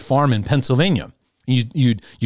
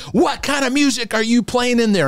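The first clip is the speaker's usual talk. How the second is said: high, indignant parent complaining; mock a scandalised parent; 13 semitones higher than usual. Upset parents questioning the music.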